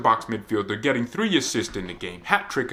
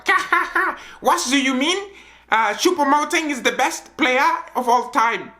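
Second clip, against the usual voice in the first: much higher, laughing fake German accent; mock German accent; 14 semitones higher, 3 dB louder than usual. Hansi Flick dismissing fans, in mock German accent. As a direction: scoff in a hammed-up German accent. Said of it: "in his German accent".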